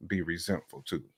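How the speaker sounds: usual speaking voice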